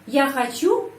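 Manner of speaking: хочу lifted sharply to make a question; question intonation. Shown as a demonstration. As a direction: pitch jumps up on the stressed end of хочу. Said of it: "apakah saya mau".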